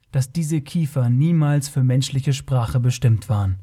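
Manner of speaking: ordinary delivery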